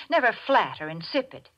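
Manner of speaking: ordinary delivery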